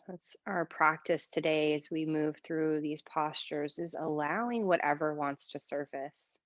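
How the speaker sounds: calm yoga teacher voice with long pauses; guided meditation. Shown as a demonstration. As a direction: ease them into stillness, leaving space between phrases. Leads breathing meditation in yoga class.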